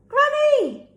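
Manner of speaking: high, loud exclaimed "Granny!"; surprised exclamation. Shown as a demonstration. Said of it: "reason why I'm saying it in a voice like that".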